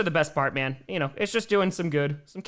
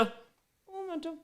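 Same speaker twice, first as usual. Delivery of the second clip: high drawn-out un momento; 13 semitones higher, 11 dB quieter, slower than usual. Pretend. Streamer asks chat to wait.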